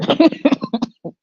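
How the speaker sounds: high laugh at own joke; real laugh. Real, not acted. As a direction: crack up at your own parody.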